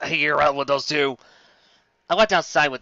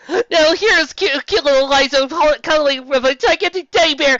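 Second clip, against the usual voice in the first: gushing parent; 12 semitones higher, 8 dB louder than usual. Acted. Proud mom showing baby pictures, gushing coo.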